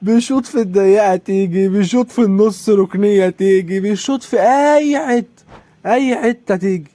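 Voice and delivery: high, slow sing-song mockery; mocking singsong. Real, not acted. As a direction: drag it out like you're taunting. Mocking the opponent's lucky shots. (تهكم بنغمة ممطوطة عالية)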